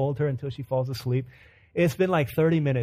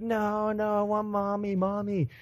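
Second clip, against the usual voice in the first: put-on high, small child's whimper; imitated crying toddler; 7 semitones higher, 4 dB quieter, slower than usual. Dad imitating daughter turning down chocolate, high whiny cry. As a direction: plead in a high mock whine, without force. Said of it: "so she keeps on crying".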